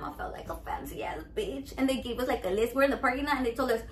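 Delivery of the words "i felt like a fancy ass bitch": unvoiced, soft confiding aside; whisper. Confessing to viewers how fancy she felt, whispered. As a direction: whisper it like a guilty secret.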